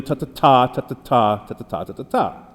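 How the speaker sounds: ta-ta-TA beaten out, weak weak strong; tapping out anapestic tetrameter; anapestic rhythm. Shown as a demonstration. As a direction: chant the beat of the meter. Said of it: "it has the rhythm".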